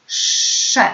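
"Sze" with a long hushing sz before the vowel; drawn-out SZ. Shown as a demonstration. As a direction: model the syllable "Sze", sz held long. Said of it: "Każdą sylabę powtarzamy".

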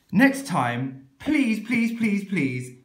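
usual speaking voice

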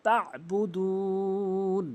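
তাবুদুন very slow, throaty ayn, long final vowel; drawn-out তাবুদুন with pressed ayn; correct recitation. Shown as a demonstration. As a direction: chant তাবুদুন with a long held oo. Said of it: "এই ভুলটি আমরা এখানে করি".

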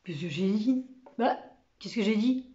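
mildly muddled mumble; bewildered stammering. A narrator's reading. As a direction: mumble it, not knowing what was so funny. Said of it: "qui bredouillait". (bredouillement perplexe)

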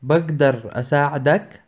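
ordinary talk

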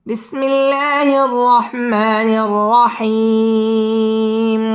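slow, melodic tajweed chant with long held vowels; Quran recitation. Real, not acted. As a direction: recite each verse slowly, pausing between them. Teacher recites the surah melodically. (ধীরে সুর করে কুরআন তিলাওয়াত)

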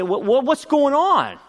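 high, stammered mock bewilderment; bewildered retold question. Family finally asks about his dream, acted bewilderment. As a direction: imitate someone baffled, voice jumping up high.

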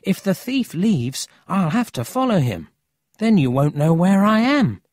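put-on high, urgent young voice; earnest boy Emil.

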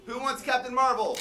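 normal talking voice

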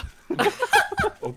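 high, sudden burst of laughter. Real, not acted. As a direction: crack up at the joke. Laughs at joke answer.

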